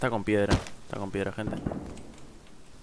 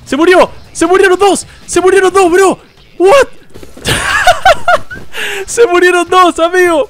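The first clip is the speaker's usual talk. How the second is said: very high, very loud shrieks with laughter; ecstatic shouting; 11 dB louder, slower than usual. Real, not acted. Shrieking to viewers as two neighbors die, very high and loud. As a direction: scream with disbelieving joy.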